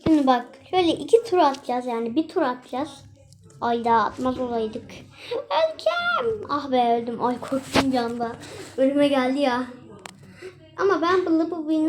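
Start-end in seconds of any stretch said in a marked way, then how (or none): 5.3-6.5 mock dying wail. Character falls in the game.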